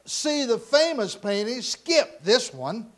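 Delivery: loud, high heckle from the back; impatient shout. Acted. Bidder at the back of the room, shouting impatiently. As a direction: shout it across the room at the auctioneer. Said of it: "finally shouted out".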